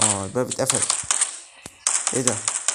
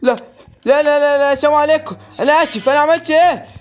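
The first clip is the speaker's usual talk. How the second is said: very high, loud frantic shouting; panicked pleading; 11 semitones higher, 10 dB louder than usual. Real, not acted. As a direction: beg for mercy in a real panic. Pleads with monster during attack.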